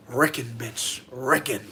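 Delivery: a hint of Puerto Rican accent. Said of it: "he does have an accent".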